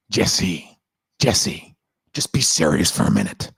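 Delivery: low-pitched American-accent impression, dead serious. Shown as a demonstration. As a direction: drop low and urge sternly in an American accent.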